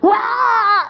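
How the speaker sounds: sudden high yelp of pain. Retold or imitated. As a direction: yelp as if scalded. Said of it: "the guy jumped out and said".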